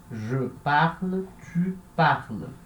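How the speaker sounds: slow, careful French; slow teacher model; slow demo. Shown as a demonstration. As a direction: spell out je parle and tu parles slowly. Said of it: "Então, se pronuncia igual".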